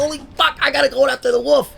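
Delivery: high-pitched replay of pre-stage nerves; worked-up self-talk. Retold or imitated. Speaker retells psyching himself before following the Wolf, worked up. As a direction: voice jumping way up, all worked up.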